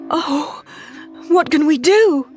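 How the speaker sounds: high, loud cry of alarm; desperate plea over the sick sheep; panicked cry; cried out. A narrator's reading. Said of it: "she cried".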